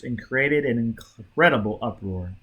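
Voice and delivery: ordinary delivery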